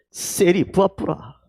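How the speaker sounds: slow Gyeongsang dialect saying of 세리 부어뿌라. Shown as a demonstration. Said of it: "우리 경상도 말로 뭐라 하면 되죠".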